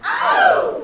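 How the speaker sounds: very high, loud whooping "ow". Shown as a demonstration.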